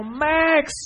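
high, loud call to a dog; retold yell. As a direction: yell the name across a yard. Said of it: "and I yell".

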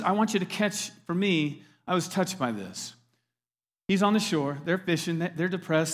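ordinary talk, nothing marked

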